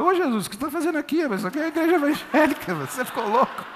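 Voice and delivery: a lightly voiced scandalized believer, fast; mock astonished churchgoer. Mocking a church member's surprise. (crente escandalizado, rápido e agudo)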